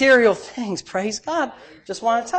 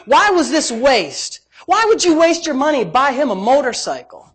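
indignant grumblers; 6 semitones higher, 3 dB louder than usual. Retold or imitated. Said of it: "there were some that sat indignation".

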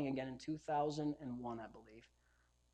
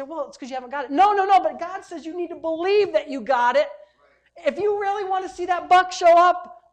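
loud, high-pitched preaching; ringing, emphatic sermon delivery; impassioned preaching; 9 semitones higher, 9 dB louder than usual. Real, not acted. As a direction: preach it at full force, pitched up. Preaches at full force.

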